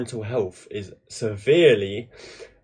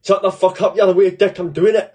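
yelling it, higher and louder; loud outburst; 8 semitones higher, 7 dB louder than usual. Retold or imitated. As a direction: blast it out to silence the others. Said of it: "and I was like".

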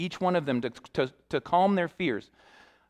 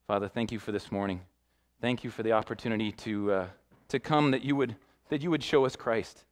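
low, soft prayer voice; 5 semitones lower, 3 dB quieter than usual. Real, not acted. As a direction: settle into a quiet, humble prayer. Leads closing prayer.

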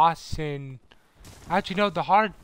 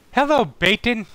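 excited greeting; 7 semitones higher, 6 dB louder than usual. Real, not acted. Greeting a chatter on stream.

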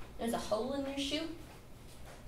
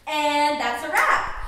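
director call; 9 dB louder, slower than usual. Acted. Director ends the shoot.

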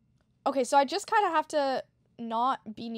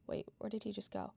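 whispering while hiding; 11 dB quieter than usual. Real, not acted. Hiding, quiet.

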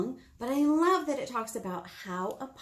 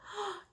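a hammed-up sharp gasp of wonder; 10 semitones higher, 9 dB quieter than usual. Librarian to storytime kids, a mock amazed gasp.

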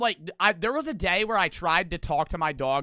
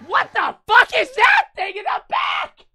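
very high, loud shriek of disbelief; shocked yell; 10 dB louder than usual. Real, not acted. Streamer spots a strange dog on screen, shocked high yell. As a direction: yell it out in shrill, real shock.

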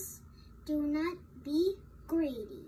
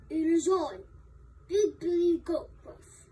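loud big-goat character voice; 7 dB louder than usual. Acted. Big goat answering the troll, loud character voice. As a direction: announce yourself loudly as the big goat.

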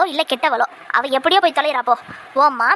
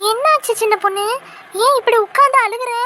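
high, startled worry; raised, anxious questioning; alarmed concern; 6 semitones higher than usual. Acted. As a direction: ask in sudden alarm at a friend's tears. Friend suddenly finds her crying.

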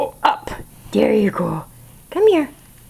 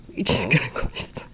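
low fast whisper to a sleepy pet; whispered aside to the drowsy kitten; 13 semitones lower, 7 dB quieter, faster than usual. Real, not acted. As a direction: hush it, quick and voiceless.

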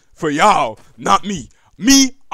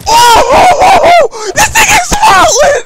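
shocked yelling; 15 dB louder, slower than usual. Real, not acted. Overwhelmed by a brutal enemy.

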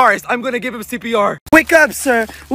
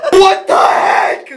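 high, slow startled yell; startled cry; 6 semitones higher, 3 dB louder, much slower than usual. Real, not acted. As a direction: jump at a sudden touch. Roach touches him on toilet.

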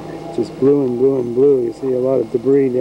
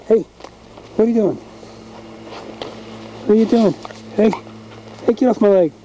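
high, protesting hey-stop-that voice; scolding the dog; 9 semitones higher, faster than usual. Real, not acted. Owner shoos the dog off his leg, high protest. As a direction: tell the pet off in a raised pitch.

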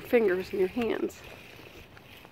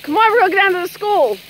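loud, higher outdoor call; 10 semitones higher, 6 dB louder than usual. Real, not acted. Rounding up kids to go to school, calling out.

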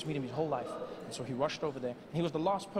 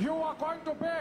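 high, quick proclamation; triumphant; 7 semitones higher, faster than usual. Acted. Mandela proclaiming Obama's future, high excited voice. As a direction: declare it high and fast like a prophecy.

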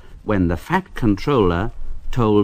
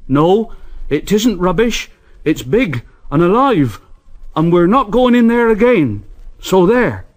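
higher, louder insistent workmen; stubborn workman; 7 semitones higher, 4 dB louder than usual. A narrator's reading. Workmen refusing the foreman, higher and insistent. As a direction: dig in and refuse, building.

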